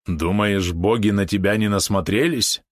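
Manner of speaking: plain reading voice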